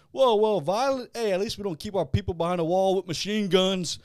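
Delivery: loud, high mock New York outrage; Paulie. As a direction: bark back as outraged Paulie. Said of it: "Paulie goes".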